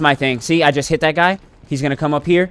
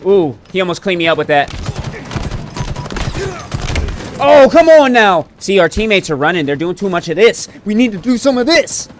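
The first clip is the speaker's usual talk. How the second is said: buzzing with hype; too much caffeine; 3 semitones higher than usual. Real, not acted. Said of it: "Sorry, I'm kinda wired".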